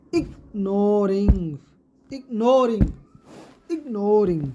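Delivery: ignoring with a long, stressed NOR; short "ig", pause, then lean on NOR in "ignoring"; "ignoring" split slowly, weight on the middle; stressed middle syllable. Shown as a demonstration. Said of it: "इस प्रकार से".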